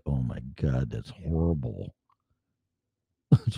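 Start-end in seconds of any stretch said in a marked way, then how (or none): 0.1-2.0 low, hushed groan of dismay; quietly appalled, hearing his early recordings; muttered disgust at old shows. Retold or imitated. Host recalls his first shows, hushed appalled groan. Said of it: "I'm like".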